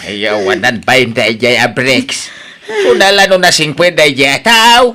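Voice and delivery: loud, high, enraged outburst; furious outburst. Acted. Father raging at Briggs for hurting his daughter. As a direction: rage at the abusive husband.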